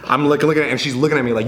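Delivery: normal talking voice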